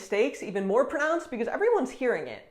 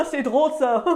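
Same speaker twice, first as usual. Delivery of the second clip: louder, laughing, amused; laughing mockery; 6 semitones higher, 7 dB louder than usual. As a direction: find it funny and laugh it off. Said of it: "a French person will say".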